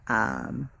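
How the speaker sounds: ordinary delivery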